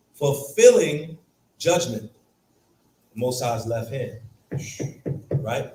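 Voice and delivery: ordinary talk, nothing marked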